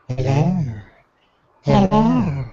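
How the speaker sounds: creepy mixer voice; spooky processed voice; creepy voice effect. Pretend. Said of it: "this is a creepy voice".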